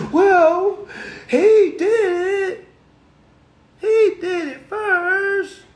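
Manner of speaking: put-on high, slow, whining kid voice, close to tears; whiny child. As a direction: whine it like a kid caught blaming someone else. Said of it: "That's how we sound".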